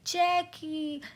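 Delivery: high cry for help while falling; alarmed cry. A narrator's reading. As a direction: yelp his friend's name in fright. Packie falling into river. (गिरते हुए ऊँची डरी पुकार)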